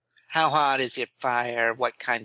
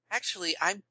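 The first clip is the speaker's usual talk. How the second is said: prim correction; 5 semitones higher, faster than usual. Acted. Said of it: "clears her throat and says".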